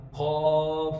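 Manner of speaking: قاف from the back with a long full vowel; slow, heavy قاف; thick, heavy قاف. Shown as a demonstration. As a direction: say قاف full-mouthed and drawn out. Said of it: "اس کو موٹا کر کے پڑھیں گے".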